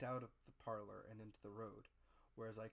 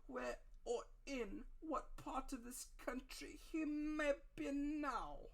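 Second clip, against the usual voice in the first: high, slow, whining blind beggar's plea; high drawn-out beggar's sing-song; odd sing-song; 13 semitones higher, 6 dB louder, slower than usual. A narrator's reading. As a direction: wheedle it out like a pitiful blind beggar. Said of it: "raising his voice in an odd sing-song".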